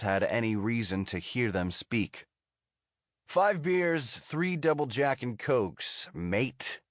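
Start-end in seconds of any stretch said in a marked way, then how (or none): 3.3-6.1 higher-pitched American voice; American accent. A narrator's reading. 6.1-6.8 American attempting a London accent; mock-London twang from an American; a badly faked London "mate"; imagined London accent. A narrator's reading. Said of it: "in what he imagines is a London accent".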